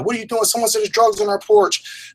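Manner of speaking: high, frantic, alarmed questioning; panicked daughter. Retold or imitated. Daughter panicking over a late-night call, high and frantic. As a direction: pitch way up, scared and rattled.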